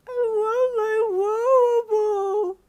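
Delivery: put-on high, loud crying toddler; panicked toddler scream. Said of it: "Koemi suddenly screamed in a panic".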